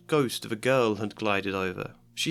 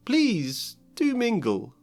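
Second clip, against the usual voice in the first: stately ghost host; 5 semitones higher than usual. Acted. Nick hosting, slow.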